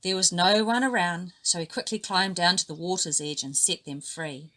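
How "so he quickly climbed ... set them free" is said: usual speaking voice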